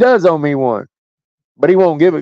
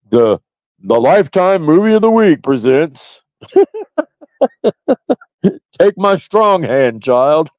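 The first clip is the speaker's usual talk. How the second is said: TV movie announcer. Pretend. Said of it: "that'd be like a Lifetime movie".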